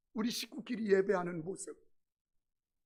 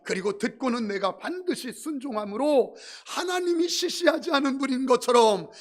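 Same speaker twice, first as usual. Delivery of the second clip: full-force preaching; 3 semitones higher, 10 dB louder than usual. Acted. Pastor exhorts the congregation at full volume.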